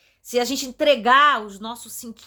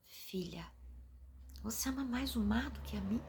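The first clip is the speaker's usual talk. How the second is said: hushed; 15 dB quieter than usual. Retold or imitated. Voicing God's gentle question.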